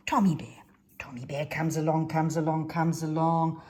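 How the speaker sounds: ordinary delivery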